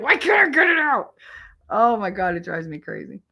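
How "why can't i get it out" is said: high, loud exasperated yell; a real burst of exasperation, pitched way up; frustrated shout. Retold or imitated. Retold frustration with stuck food.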